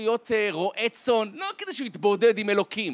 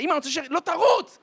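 urgent protest; 11 semitones higher, 5 dB louder than usual. Acted. Child and mother argue while fleeing.

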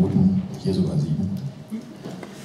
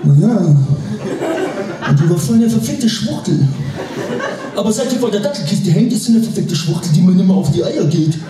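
put-on crude young mother; 5 semitones higher than usual.

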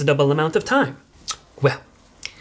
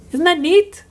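high, chirpy and eager; chirpy excitement; 11 semitones higher, 5 dB louder than usual. Acted. Mother on a voicemail, chirpy delight. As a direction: sell the fun fact brightly.

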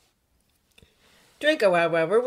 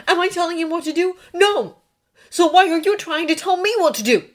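loud, raised, rapid-fire indignation; demanding; 12 semitones higher, 9 dB louder than usual. A narrator's reading. Draco telling Hermione off, indignant. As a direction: argue heatedly, punching the questions. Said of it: "he demanded".

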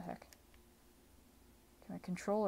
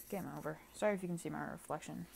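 usual speaking voice

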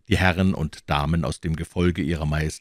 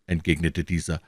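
ordinary delivery

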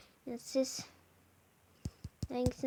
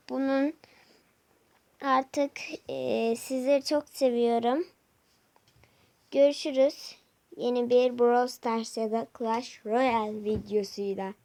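loud outro; 7 dB louder than usual. Real, not acted.

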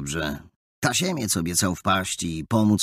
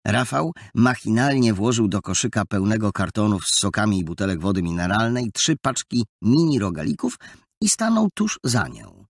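plain reading voice

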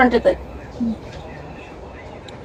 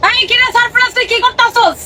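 mock shout; 7 semitones higher than usual. Wife shouting in dialect skit.

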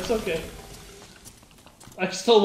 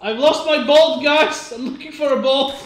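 calling out; 4 semitones higher, 7 dB louder than usual. Real, not acted. Calling out to teammates.

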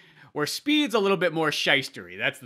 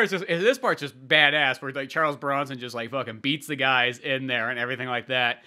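ordinary talk

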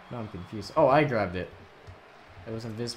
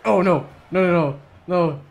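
loud, higher string of no; alarmed protest; 5 semitones higher, 7 dB louder than usual. Real, not acted. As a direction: call out in alarm at the game. Play going wrong.

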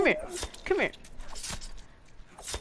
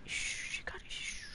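whisper; 19 dB quieter than usual. Real, not acted. Shushing a friend while playing.

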